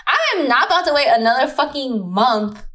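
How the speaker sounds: high, emphatic retold indignation; indignant refusal. Woman retelling her refusal to wait for him, indignant. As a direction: refuse firmly, pitch lifted.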